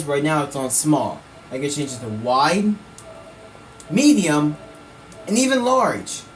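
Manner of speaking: ordinary talk, nothing marked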